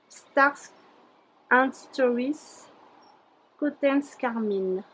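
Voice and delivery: English product name in a French accent; careful, halting English with a French accent. Real, not acted. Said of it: "toujours de mon accent magnifique en anglais".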